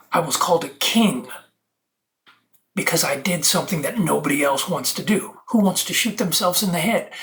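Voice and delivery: ordinary delivery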